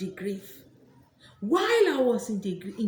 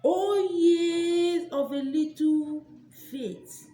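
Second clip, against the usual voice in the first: drawn-out scripture; 7 semitones higher, 3 dB louder, much slower than usual. Pretend. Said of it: "Bible say".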